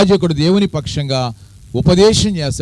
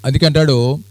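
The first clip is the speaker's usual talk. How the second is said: normal talking voice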